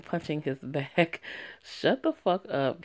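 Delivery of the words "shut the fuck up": ordinary talk, nothing marked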